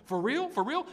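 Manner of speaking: rising, high incredulous questions; amazed David. Acted. David voiced, amazed at reward.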